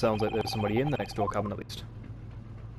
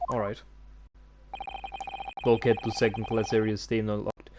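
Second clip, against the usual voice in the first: put-on stiff Russian accent; gruff Russian sailor. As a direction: dismiss them like a Russian guard. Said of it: "I like to do Russian accents".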